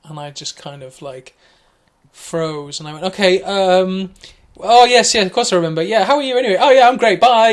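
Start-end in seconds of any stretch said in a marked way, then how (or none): 3.0-7.5 loud, high, panicked pleasantries; flustered rush. Retold or imitated. Flustered loud brush-off to a caller he barely remembers. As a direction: gabble it out to escape the call. Flustered hasty phone exit.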